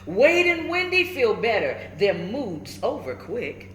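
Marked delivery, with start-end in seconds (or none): none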